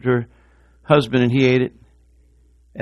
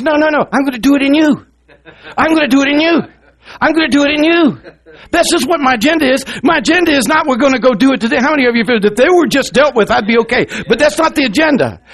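high, loud revival-style preaching; full-force preaching; 12 semitones higher, 6 dB louder than usual. Acted. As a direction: drive each line home at full volume.

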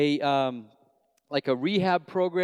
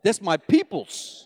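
imitated New York churchgoers, louder; New York accent; 5 semitones higher, 4 dB louder than usual. New York church welcoming one of their own, New York accent. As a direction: play approving New Yorkers in their accent. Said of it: "And then they get me".

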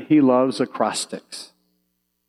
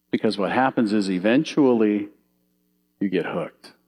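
ordinary delivery

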